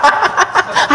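a breathy, high giggle of delight; light high-pitched titter; giddy high giggle. Retold or imitated. Eager listener laughing.

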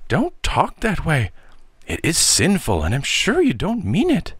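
high-pitched, dismayed scolding; horrified aunt. A narrator's reading. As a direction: plead in shocked disapproval, pitched high. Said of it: "exclaimed the horrified and too credulous aunt".

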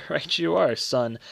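brief laugh leading into the words; amused but a little uncomfortable; uneasy laugh. Acted. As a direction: chuckle uneasily, then agree. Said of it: "laughed uneasily".

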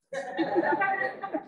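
light high-pitched laugh; real high chuckle; short laugh. Real, not acted. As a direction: laugh briefly at your own remark. Teacher laughs after fast-talk remark.

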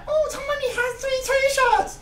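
high, slow mocking voice of the commenters; squeaky sarcastic commenter impression. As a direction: parrot the comment section in a silly high whine. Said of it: "you guys in the comment section are like".

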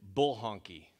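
a hint of a stern dad, low and firm; mild mock anger, dropped low; mock angry dad. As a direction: lightly mimic dad's mad catchphrase. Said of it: "the only way that they knew that I was mad".

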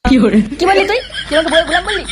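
loud, high indignant challenge; offended outburst. Acted. Friend taunted as his wife's slave, offended. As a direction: flare up at the insult.

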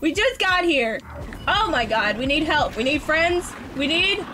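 high, rising anxious pleading; high anxious rant. Real, not acted. Gamer begging for friends and protection, high and anxious. Panicking for help.

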